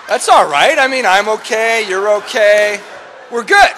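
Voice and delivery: high, loud insistence that all is fine; mock-reassuring shout.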